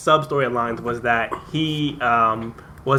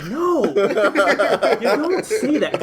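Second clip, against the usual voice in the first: higher, slow, laughing protest; mock Amy Schumer; 8 semitones higher, much slower than usual. Retelling Schumer's line to the cohosts, slow laughing protest. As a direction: drag it out while cracking up. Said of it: "Amy Schumer was like".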